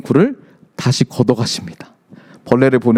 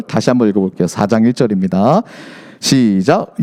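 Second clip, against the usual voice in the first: ordinary talk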